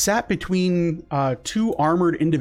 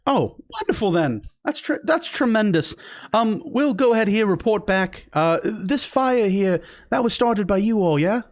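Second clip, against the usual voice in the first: chipper guard; 6 semitones higher than usual. Acted. Guard thanks party, asks about fire.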